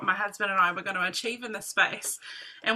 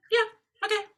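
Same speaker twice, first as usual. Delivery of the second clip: higher, light, easy agreement; husband's casual yes; 11 semitones higher, much faster than usual. Retold or imitated. Husband agreeing to sell his Harley, lightly voiced and casual. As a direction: toss it off like it's nothing.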